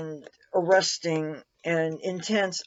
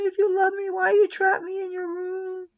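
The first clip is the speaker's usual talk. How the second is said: high whiny cat voice, wailing; voicing the cat; 10 semitones higher than usual. Owner voicing her cat, whiny high plea. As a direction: plead like a wronged pet, much higher. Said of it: "And you're saying".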